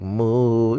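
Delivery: low, drawn-out mock moo; cow moo. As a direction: moo back like a dumb cow. Cow answers the space question.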